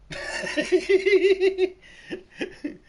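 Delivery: high, loud burst of laughter; cackles, loud and high. Real, not acted. Streamer laughing at his own mixed-up joke, loud high cackle.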